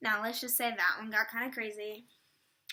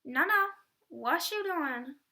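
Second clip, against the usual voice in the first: a higher sassy challenge; sassy call-out; 5 semitones higher, 3 dB louder than usual. Retold or imitated. Fan scolding the girl who grabbed the pick, sassy. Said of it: "I was like".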